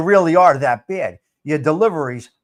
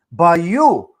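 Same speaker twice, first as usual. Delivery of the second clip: ordinary delivery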